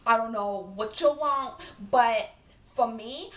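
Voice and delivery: loud, sassy telling-off in a put-on voice; yelling character. Shown as a demonstration. Yelling at someone to leave her alone, sassy character. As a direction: tell someone off loudly, playing it up. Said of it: "if I need to yell at someone".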